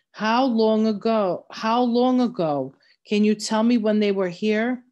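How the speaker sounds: insistent demand. Acted. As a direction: raise your voice and demand an answer. Samuel presses the old man again.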